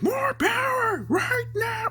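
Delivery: very high, slow strained cry; English dub yell. Acted. Imitating the English version's demand for power, strained yell. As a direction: stretch each word in a high anime yell. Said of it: "the English version was like".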